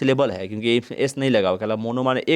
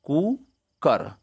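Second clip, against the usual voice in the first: Cooker slowly, कू, a pause, then कर; cooker broken syllable by syllable; split into two; 4 semitones higher, much slower than usual. Shown as a demonstration. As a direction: pull cooker apart into two beats. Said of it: "दो बार बोलते हैं".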